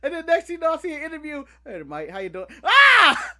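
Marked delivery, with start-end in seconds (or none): 1.7-2.4 mock English accent. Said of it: "they have an English accent". 2.6-3.3 high, loud scream of dismay; mock horrified scream. Fan hears the actor's accent, mock scream.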